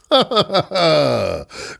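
big amused laugh; hearty laugh. A narrator's reading. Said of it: "Kacagott Dörmögő úr".

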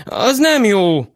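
put-on panting little boy; out of breath. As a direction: gasp it out breathless.

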